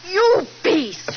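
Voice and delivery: loud, high, outraged insult; furious cry. Acted. Wife insulted by Roderick, furious shout. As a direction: spit it at him in fury.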